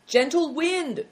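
high-pitched password call; prompt cry. A narrator's reading.

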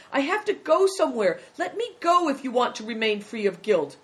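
high, loud, desperate plea; nearly crying. Acted. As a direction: plead as if close to tears. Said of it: "who was nearly crying".

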